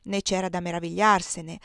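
ordinary delivery, nothing marked

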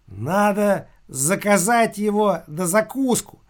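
a sly imp ordering food; demon voice. A narrator's reading. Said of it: "проговорил бес Машка".

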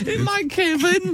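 very high, drawn-out mock worry; slow, squeaked mock alarm; fearful Leeds friend. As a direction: fret comically, way up high. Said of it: "that's what they say, though".

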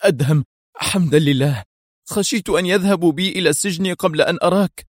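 high, emotional outcry of relief; near tears. A narrator's reading. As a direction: cry out as if close to weeping. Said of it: "يهتف في صوت أقرب إلى البكاء". (صوت مرتفع أقرب إلى البكاء)

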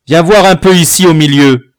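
forceful fatherly command; stern summons. A narrator's reading. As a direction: call the culprit over angrily. Father summons son for inspection.

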